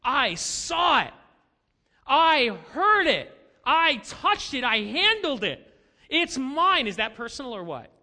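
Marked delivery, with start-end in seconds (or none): 0.0-6.9 loud, insistent eyewitness declaration; emphatic testimony. Acted. Pastor voices the apostle John's testimony, loud emphatic declaration. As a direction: punch each short claim slowly and forcefully. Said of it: "Did you hear over and over what he says?".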